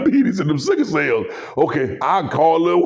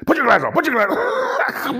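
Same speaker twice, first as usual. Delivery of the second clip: higher, mock Shannon Sharpe voice; 9 semitones higher, much faster than usual. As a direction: squawk it like Shannon.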